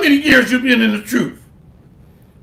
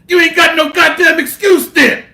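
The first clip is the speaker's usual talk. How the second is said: fired-up shout; 5 semitones higher than usual. Real, not acted. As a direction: call them out hard. Preacher rants at the lazy.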